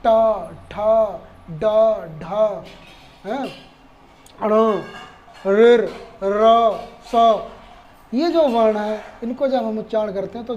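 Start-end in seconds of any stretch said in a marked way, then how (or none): 0.1-7.4 ट ठ ड ढ ण ऋ र स called out one by one, louder; retroflex sounds demo. Shown as a demonstration. Teacher loudly sounding out ट ठ ड ढ ण for students to copy. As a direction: punch out each of ट ठ ड ढ ण loudly. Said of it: "वो बोल के देख सकते हैं".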